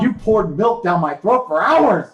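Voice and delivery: high, loud, drawn-out protest; outraged yell. Real, not acted. Podcaster accuses friend over a milk stunt, yelled outrage. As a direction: yell the grievance for all to hear.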